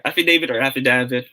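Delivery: "affidavit" with DAY, then with DA as in "dad"; long-A "affidavit", then short-A "affidavit"; two pronunciations contrasted. Shown as a demonstration. Teacher corrects the order of options, contrasting affidavit pronunciations. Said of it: "if it is pronounced as affidavit".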